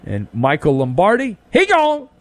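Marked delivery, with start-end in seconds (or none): 1.5-2.1 comic high he gone, pitched way up. Pretend. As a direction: toss it off in a high mocking voice. Comic slang about Lombardi.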